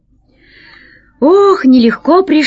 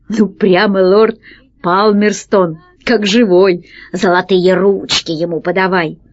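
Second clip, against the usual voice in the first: plain reading voice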